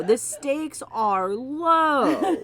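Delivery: laughing it out, drawn slow. Real, not acted. Joking to his cohost, laughing slowly.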